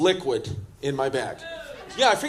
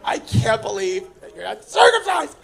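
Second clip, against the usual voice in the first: mock disgusted girlfriend, higher and louder; 4 semitones higher, 3 dB louder than usual. Girlfriend shaming him about not being circumcised, mock disgusted shout. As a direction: imitate her grossed-out shout. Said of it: "She was like".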